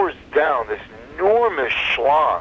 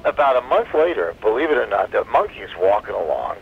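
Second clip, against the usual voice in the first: normal talking voice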